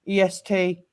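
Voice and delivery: normal talking voice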